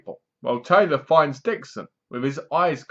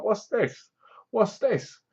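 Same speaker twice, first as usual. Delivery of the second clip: puzzled viewer; 7 semitones higher, 3 dB quieter, slower than usual. Pretend. Viewer puzzled by three wardens.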